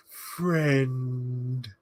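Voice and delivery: long drawn-out single word; mock a stumbling stranger slowly forming a word; stretched-out, halting wizard voice; Gandalf. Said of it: "he goes up to Nori, and he's like".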